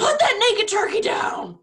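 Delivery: high-pitched comic yell; mock shout. Joking about E.T. with friends, high mock shout.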